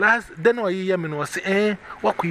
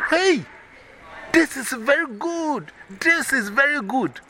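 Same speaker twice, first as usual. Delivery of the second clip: excited praise; 9 semitones higher, slower than usual. Retold or imitated. Mother excitedly praising, retold.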